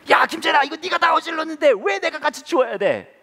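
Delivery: high, loud outraged yell; angry dad. Retold or imitated. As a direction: protest at the child, full voice. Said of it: "더 화가 나가지고". (화난 아빠가 소리치며 따지듯)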